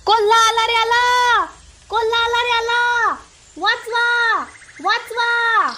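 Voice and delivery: high, loud cries for help; shouting false alarm. Pretend. Boy tricking villagers about a fox, shouting for help. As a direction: holler the alarm with long, high endings. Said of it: "मी लगेच ओरडतो".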